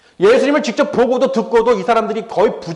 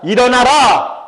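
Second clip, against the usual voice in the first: raised, ringing command; commanding call; 4 semitones higher, 5 dB louder, slower than usual. Retold or imitated. Jesus commands the dead girl to rise, loud and commanding.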